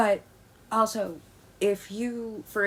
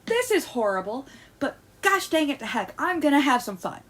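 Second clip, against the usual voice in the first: mock cheerful resolve; 6 semitones higher than usual. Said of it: "you're just like".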